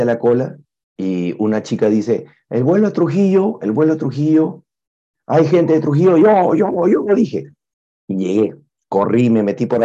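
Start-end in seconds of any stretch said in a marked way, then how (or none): 2.5-6.2 calling out. Retold or imitated. Agent calling out in crowded queue. 6.2-7.1 high, loud eager shouts of me. Retold or imitated. Eagerly answering the call.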